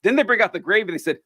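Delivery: usual speaking voice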